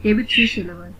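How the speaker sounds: ordinary delivery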